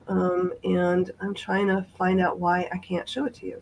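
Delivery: usual speaking voice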